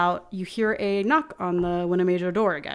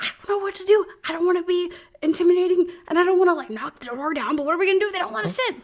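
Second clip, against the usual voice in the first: higher, fast, flustered cultist; panicked cultist; 9 semitones higher, much faster than usual. Acted. Cultist panics to the others at the door, high fast fluster. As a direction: babble out worries in a rush. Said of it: "And I was like".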